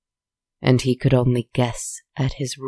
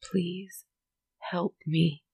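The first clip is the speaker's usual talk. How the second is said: soft, higher, fragile cry for help; desperate plea; 5 semitones higher, 8 dB quieter, slower than usual. Acted. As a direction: plead in a hushed, breaking voice. Desperate plea to silent figure.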